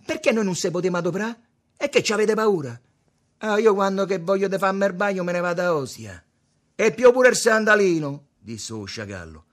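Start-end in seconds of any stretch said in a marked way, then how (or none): 0.0-8.3 high, loud taunting; loud bickering. A narrator's reading. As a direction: jeer back at full voice. Battibecco a gran voce fra giovani. (battibecco a gran voce)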